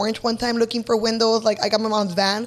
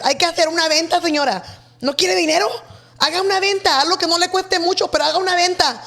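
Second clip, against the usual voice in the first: pushy sales pitch; 6 semitones higher, faster than usual. Retold or imitated. Pushing the señora to sell.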